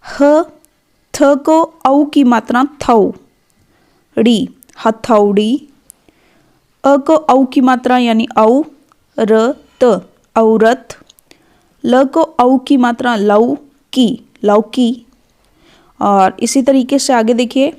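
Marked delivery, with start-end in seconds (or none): 0.0-5.7 हथौड़ी split into ह, थौ, ड़ी then joined slowly; syllable by syllable. Shown as a demonstration. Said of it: "उच्चारण ध्वनि जो है वो कैसी होती है". 11.8-15.1 लौ and की said slowly, then लौकी joined; slow syllable spelling. Shown as a demonstration. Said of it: "उच्चारण ध्वनि जो है वो कैसी होती है".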